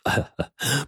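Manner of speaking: a short friendly laugh before speaking; kindly chuckle. A narrator's reading. Master Lu laughs kindly to the rescued man, chuckling. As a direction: shopkeeper chuckles at the stranger. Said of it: "陆桥向大汉笑道".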